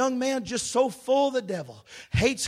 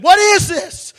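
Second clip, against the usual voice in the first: much higher, louder astonished cry; amazed joy; 9 semitones higher, 13 dB louder than usual. Retold or imitated. Young man just saved, crying out in amazed joy. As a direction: burst out in wonder at the change.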